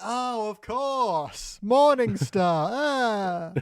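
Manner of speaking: drawn-out slow realization; slow dawning realization. Retold or imitated. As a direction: let it dawn on you slowly. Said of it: "And then they'll be like".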